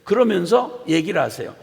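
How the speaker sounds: normal talking voice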